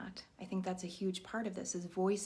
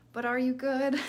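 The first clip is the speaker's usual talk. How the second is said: louder, higher, pressing the question home; pointed question; 7 semitones higher, 11 dB louder than usual. Acted. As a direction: push past the polite answer. Challenging the mask.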